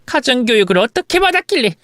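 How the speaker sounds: brief, acted reproachful jab; scolding mother-in-law. Mother-in-law questioning daughter-in-law's upbringing, scolding. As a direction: look down on her upbringing.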